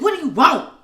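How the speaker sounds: hammed-up switch to attitude; snappy and hostile. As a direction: bite back like a moody twin. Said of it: "The next minute".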